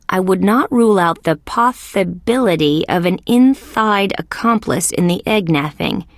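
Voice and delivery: S sounds turned to TH, as in "inthide" for "inside"; lisping. Acted. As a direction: read it with a lisp, "possibility" as "pothibility". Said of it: "who writes with a lisp".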